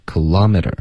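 kilometer with the t softened like a d; kilometer as "kuh-LAH-mih-der", r-coloured ending; American flapped T. Shown as a demonstration. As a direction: say kilometer American style, voiced t. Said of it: "t的音一般会浊化".

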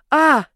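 short high, loud cry of pain; sudden yelp. A narrator's reading. Heroine can't bear the pain, sharp cry. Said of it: "Не выдерживаю и кричу".

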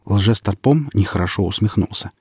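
plain reading voice

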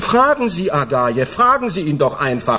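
high, pressed protest, voice climbing; rising indignant protest; agitated protest. Acted. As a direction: insist desperately that he is innocent. Accused man protests his innocence.

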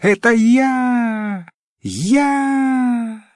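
high, long-held boastful cry; shouting with all might. A narrator's reading. Frog forgets all caution to claim credit, high drawn-out shout. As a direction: cry out high, stretching the vowel. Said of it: "закричала изо всей мочи".

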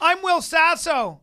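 high, slow, loud mock holler; Will Sasso. Prank on a friend in a crowd, embarrassing loud announcement. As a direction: shout it out to the whole room. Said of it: "I did that to Will Sasso".

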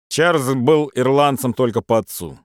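usual speaking voice

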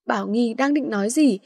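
ordinary delivery, nothing marked

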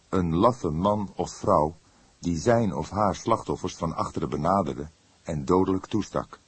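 ordinary delivery, nothing marked

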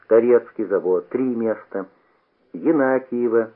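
ordinary delivery, nothing marked

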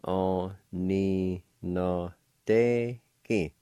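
Aninadeki stretched syllable by syllable, very slowly. Shown as a demonstration. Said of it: "it's pronounced like this".

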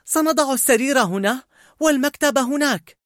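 high, animated character voice; eager planning. Acted. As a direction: lay out the plan with excitement. Joy planning the room eagerly.

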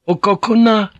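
high, loud woman's order; commanding. A narrator's reading. Fujiko halts the samurai at gunpoint, high and commanding. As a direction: order them sharply, pitched high. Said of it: "she ordered".

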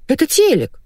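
high-pitched wriggling evasion; squirming excuse. A narrator's reading. Husband squirming out of wife's accusation, evasive. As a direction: dodge with a high, hasty excuse. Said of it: "заюлил Колян".

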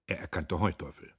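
ordinary delivery

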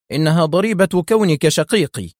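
plain reading voice

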